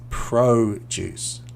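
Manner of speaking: "produce" said slowly as PRO-duce with a stretched O; noun produce, long O. Shown as a demonstration. Teacher showing the long O of noun "produce", slow. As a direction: draw out the O in "produce". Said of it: "So the O sounds a little bit longer".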